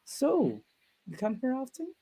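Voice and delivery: high, sugary flirt voice, with the last words going soft; imitating a hammy flirt; cheesy pickup line. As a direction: lay on a mock pickup line. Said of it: "I'm like".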